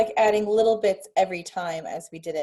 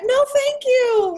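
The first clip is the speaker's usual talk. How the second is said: very high, slow, louder prim little voice; child's polite refusal; 7 dB louder, much slower than usual. Retold or imitated. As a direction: play the toddler being extra proper. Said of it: "Just like that".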